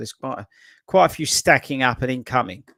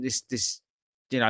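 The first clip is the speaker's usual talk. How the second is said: ordinary talk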